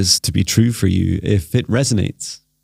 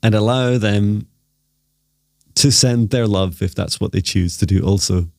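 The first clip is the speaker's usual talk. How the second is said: guided meditation. Acted. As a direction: gently lead them into stillness.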